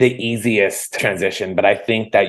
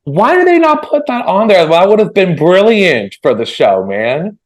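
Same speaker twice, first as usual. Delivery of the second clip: high, loud, eager exclamation; excited; 10 semitones higher, 5 dB louder than usual. Real, not acted. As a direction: burst out with delight. Host thrilled by Steve Irwin impression.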